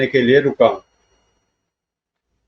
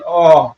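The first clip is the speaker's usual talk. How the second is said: strained cry from a squeezed neck; acted groan of pain; 3 semitones higher, 3 dB louder than usual. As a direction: groan out as the grip tightens. Neck squeezed during interrogation. (गर्दन दबने की दर्द भरी आह)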